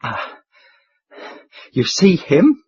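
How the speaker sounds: excited panting. A narrator's reading. Hotel keeper to the chairman, excited and panting. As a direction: gasp it out, agitated. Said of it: "panted the excited hotel keeper".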